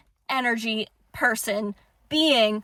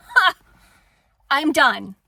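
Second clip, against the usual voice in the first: ordinary talk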